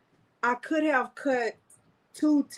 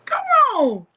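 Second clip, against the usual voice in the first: ordinary talk, nothing marked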